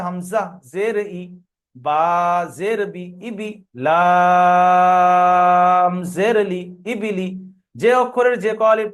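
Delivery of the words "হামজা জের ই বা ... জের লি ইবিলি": "লাম" held very long and loud on one note; segmented drill, long letter names and short vowels; spelling recitation. Shown as a demonstration. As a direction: spell "ইবিলি" piece by piece, stretching "লাম", with short "ই", "বি", "লি". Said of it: "প্রথমে বানান করতে হবে".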